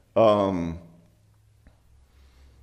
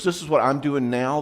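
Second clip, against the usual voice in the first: usual speaking voice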